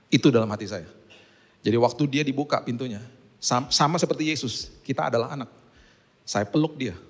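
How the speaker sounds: usual speaking voice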